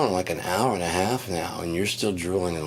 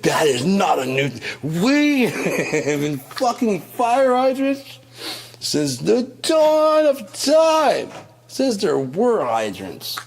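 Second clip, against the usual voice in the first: much higher, louder, slow hammed-up shout with laughter; mock indignant fan; 14 semitones higher, 8 dB louder, slower than usual. Mocking hydrant fans defending their kink, hammed-up high slow shout. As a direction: proclaim it like an outraged zealot. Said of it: "There's people that fucking like".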